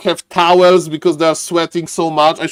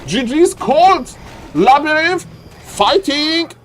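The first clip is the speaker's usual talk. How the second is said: high excited play-by-play; frantic sports commentary; 11 semitones higher than usual. Real, not acted. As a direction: still riding the hype. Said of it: "I got too excited".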